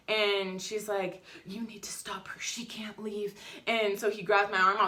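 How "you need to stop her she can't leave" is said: whispered, secretive warning; hushed as if telling on someone; urgent whisper. Retold or imitated. Nurse whispering to the security guard to stop her.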